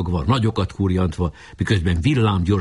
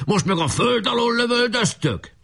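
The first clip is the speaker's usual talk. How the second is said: high indignant cry; shouting. A narrator's reading. Chief protests the underground volley, high shout. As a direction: protest in a sustained high call. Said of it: "kiáltott".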